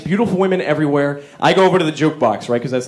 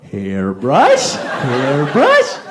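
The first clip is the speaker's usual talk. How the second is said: lightly voiced baffled echo, lower at first; dumb confused echo; 3 semitones lower, much slower than usual. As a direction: repeat the word like a confused dope, a bit lower. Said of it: "here I'm going".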